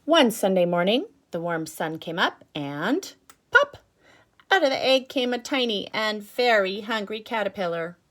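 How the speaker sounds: lively picture-book read-aloud, bright and paced; reading to children. Acted. Teacher reading a picture book to her class, storytelling voice. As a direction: draw little ones into the story.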